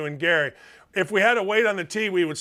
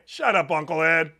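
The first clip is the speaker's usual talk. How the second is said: laughing; 3 semitones lower than usual. Real, not acted.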